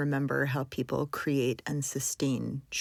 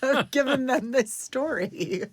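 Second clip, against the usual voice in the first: real laugh running through the words, higher and louder, slow; 10 semitones higher, 5 dB louder than usual. Real, not acted. Interviewer laughing at the guest's amazing customs story.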